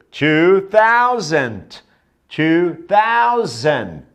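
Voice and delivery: two thousand dragged out very slowly; teacher stretches two thousand for learners; slow model pronunciation. Shown as a demonstration. Teacher drilling students, very slow model phrase. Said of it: "Então, lá, dois mil".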